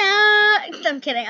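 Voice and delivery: drawn-out playful I'm kidding; loud slow kidding. Acted. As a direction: stretch it out big and loud. Jokes after fake scare.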